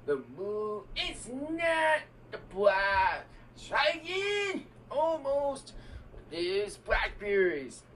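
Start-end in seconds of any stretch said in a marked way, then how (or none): 0.9-5.7 game-show host call. Pretend. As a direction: shout it like a quiz host. Kid acts as a game-show host.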